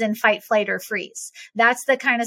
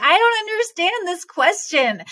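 higher, exasperated retort; frustrated; 7 semitones higher than usual. Retold or imitated. Retelling her answer to the therapist, frustrated and higher. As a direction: push back in frustration. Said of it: "and I was so frustrated".

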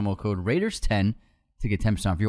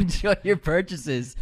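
mocking, laughing; 7 semitones higher than usual. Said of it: "what does that even mean".

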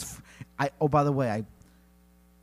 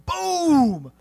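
high, loud cartoon gunshot; loud high imitated blast; shouted gun boom; 10 dB louder than usual. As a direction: bellow a boom for the musket blast. Gun sound effect.

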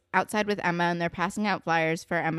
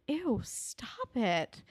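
long, drawn-out disgusted "ew"; grossed-out reaction; 5 semitones higher, 7 dB quieter, much slower than usual. Retold or imitated. Viewer reacting to Sully looking her up and down, disgusted. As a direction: recoil in disgust, slow and higher.

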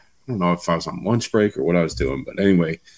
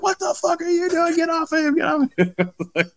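much higher, frantic shouting; shouting manager. Retold or imitated. A detail manager pulling him off the kid, frantic shouting. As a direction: break up the fight with a yell. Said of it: "he's like".

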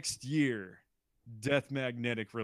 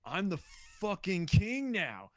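higher, slow, lightly hammed-up boast; 7 semitones higher than usual. Imitating [name] gloating over Metallica, mock boast. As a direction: crown yourself king, drawn out and smug. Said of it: "being like".

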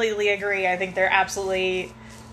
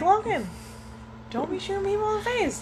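put-on high-pitched reprimand to a child; imitated parental scolding; 12 semitones higher, 4 dB quieter, faster than usual. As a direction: scold your kid in a raised-pitch voice.

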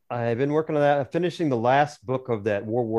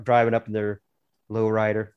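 ordinary talk, nothing marked

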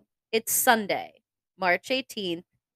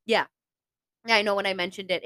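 ordinary talk, nothing marked

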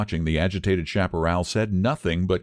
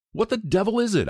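alarmed; 13 semitones higher than usual. A narrator's reading. Hunter startled by unseen beast.

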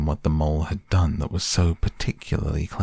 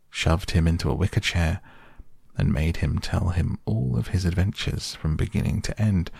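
ordinary delivery, nothing marked

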